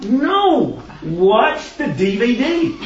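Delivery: frustrated parent. Retold or imitated. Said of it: "now you're frustrated".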